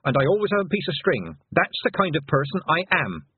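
mildly angry, exultant assertion; joyful anger. A narrator's reading. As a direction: proudly insist on who you are. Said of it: "The anger rose up joyfully".

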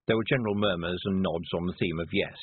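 ordinary delivery

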